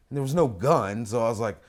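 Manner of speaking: normal talking voice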